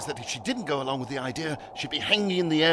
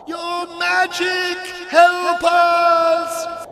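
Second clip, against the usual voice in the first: high, slow shout for help; desperate cry; 13 semitones higher, 9 dB louder, much slower than usual. A narrator's reading. Algernon calls for Fiddly's magic, desperate cry. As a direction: plead at full volume, stretched out. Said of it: "cried Algernon".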